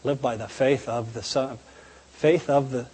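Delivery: low, quiet, puzzled reading to himself; puzzled mutter. Retold or imitated. Young man puzzling over a verse, quiet muttering. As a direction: sound out the verse half-aloud, doubting it. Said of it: "I remember balking at that".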